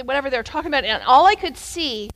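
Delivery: normal talking voice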